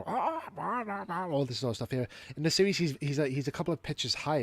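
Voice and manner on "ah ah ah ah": high mock screeching ahs; Coco Petal screeches. Reviewer mimicking Coco Petal's screeches. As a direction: squawk it out. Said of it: "he just goes like".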